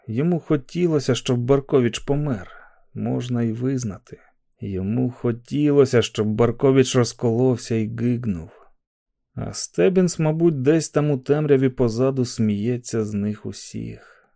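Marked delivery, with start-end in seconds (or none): none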